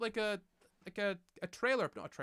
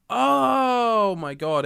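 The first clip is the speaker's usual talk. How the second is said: long drawn-out, higher "oh"; shocked realisation; 4 semitones higher, 15 dB louder, much slower than usual. Real, not acted. Streamer learns the mic is far too loud, shocked. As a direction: stretch it out in real dismay.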